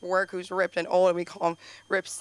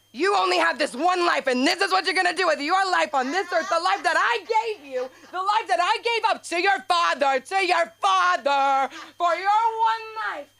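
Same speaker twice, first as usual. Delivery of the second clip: high, loud, impassioned guilt-trip mother voice; 9 semitones higher, 5 dB louder than usual. Retold or imitated. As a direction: lay the guilt on like an overbearing mother. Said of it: "this is what I said".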